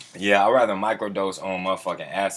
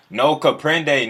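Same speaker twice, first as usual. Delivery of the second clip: mock Spanish; 5 semitones higher, 5 dB louder, much slower than usual. Mocking Spanish chat.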